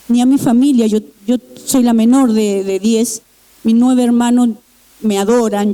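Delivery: ordinary delivery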